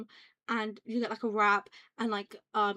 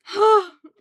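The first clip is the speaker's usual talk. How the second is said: big, loud gasp; dramatic gasp; 11 semitones higher, 17 dB louder than usual. Pretend. Streamer feigning shock at own complaint, dramatic gasp. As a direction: gasp sharply for show.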